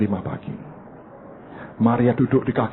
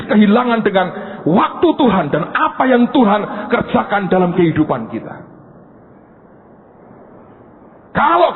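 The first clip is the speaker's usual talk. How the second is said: much higher, louder preaching; full-force preaching; 10 semitones higher, 4 dB louder than usual. Real, not acted. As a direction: drive the point home to the congregation. Preaching the point. (berkhotbah dengan berapi-api)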